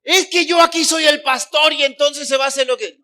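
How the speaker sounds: loud, high, pompous mock rant; arrogant pastor. Preacher mocking a pastor who demands obedience, in a loud pompous rant. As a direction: puff up like a bossy pastor who must be obeyed.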